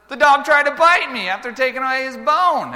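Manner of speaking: high loud upset telling; retold upset child voice; upset kid report. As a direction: blurt it out to dad. Boy tells dad excitedly.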